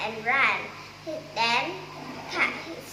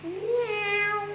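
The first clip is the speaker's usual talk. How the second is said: high mock meow from the cat; a quick high cat meow; 7 semitones higher than usual. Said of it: "made a sound".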